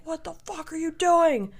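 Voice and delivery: high, loud mock outrage; imitated angry yelling. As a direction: act out the posse yelling at you. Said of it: "started yelling at me".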